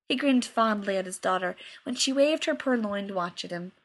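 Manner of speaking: usual speaking voice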